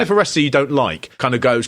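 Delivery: usual speaking voice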